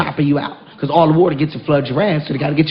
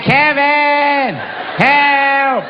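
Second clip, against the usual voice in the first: wife's cry for help; 7 semitones higher, much slower than usual. Retold or imitated. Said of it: "She's like".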